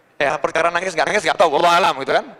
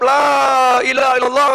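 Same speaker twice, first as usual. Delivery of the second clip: raised, high, drawn-out invocation; powerful dhikr cry; 5 semitones higher, 4 dB louder than usual. Shown as a demonstration. One utterance that shakes the universe, high ringing cry. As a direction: call it out slowly on a high note. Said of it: "Begitu sekali ngucap".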